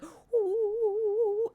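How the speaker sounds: high, loud whooshing woo; high whirly-tube woo; tube whoosh noise. Shown as a demonstration. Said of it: "the sound where it's like".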